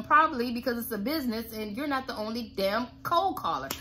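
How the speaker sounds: ordinary talk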